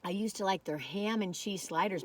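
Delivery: ordinary talk, nothing marked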